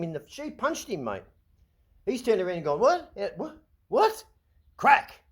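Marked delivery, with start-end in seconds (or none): 2.8-5.2 high, loud mocking what, then a crack; acted boxer reaction. Vlogger acts the boxer being hit, shouted high mock. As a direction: ham up a shocked fighter before the punch.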